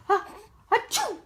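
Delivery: high playful ah-achoo; fake sneeze. Mom fakes a sneeze so baby laughs, high. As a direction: wind up a pretend sneeze.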